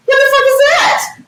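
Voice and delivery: high shocked outburst. Retold or imitated. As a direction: blurt it out in shock. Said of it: "I said".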